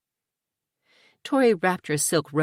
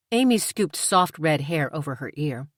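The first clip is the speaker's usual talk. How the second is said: ordinary delivery, nothing marked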